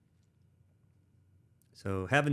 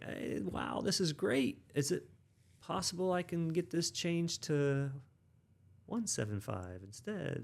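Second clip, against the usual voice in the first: mock polite request; 8 semitones higher, slower than usual. Polite request email voiced.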